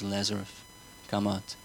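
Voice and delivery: soft, calm "Lazarus, come out" before the crowd repeats it; quiet command. Shown as a demonstration. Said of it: "Repeat with me".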